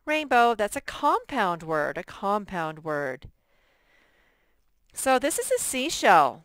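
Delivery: ordinary talk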